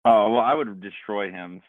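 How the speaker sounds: usual speaking voice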